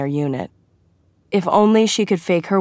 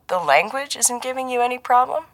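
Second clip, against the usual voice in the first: higher-pitched feigned innocence, rising at the end; a shade too innocent; 8 semitones higher than usual. A narrator's reading. Colleague teasing agent about her language skills, too innocent. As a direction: ask it just a little too innocently. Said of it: "Arun's voice was a shade too innocent".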